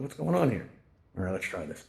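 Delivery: normal talking voice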